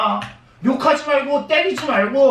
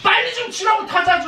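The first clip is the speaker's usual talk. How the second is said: impatient yell, much higher; shouting; 8 semitones higher than usual. Real, not acted. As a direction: hurry him up, shout it. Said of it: "귀 아프다고".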